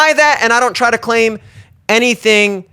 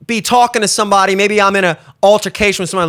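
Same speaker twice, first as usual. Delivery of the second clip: ordinary talk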